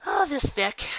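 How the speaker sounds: ordinary delivery, nothing marked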